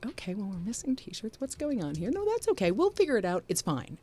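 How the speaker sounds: soft, high-pitched talking to oneself; retold self-talk. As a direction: voice your own reassurance in a small, high tone. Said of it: "I was like".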